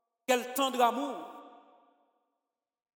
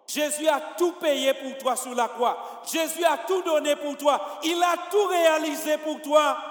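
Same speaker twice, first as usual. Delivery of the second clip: building preaching; 3 semitones higher than usual. Acted. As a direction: climb to a fervent cry.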